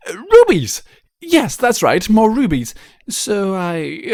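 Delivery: Rarity making up a story about diamond dogs; flustered fib. Acted. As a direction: cover the truth with overacted disgust. Rarity tells a flustered lie.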